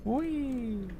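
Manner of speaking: ordinary talk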